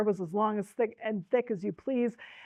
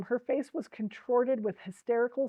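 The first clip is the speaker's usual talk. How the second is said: ordinary delivery, nothing marked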